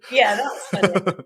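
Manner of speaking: slow, said through a laugh. Real, not acted. Answering the host while laughing. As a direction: laugh your way through the words.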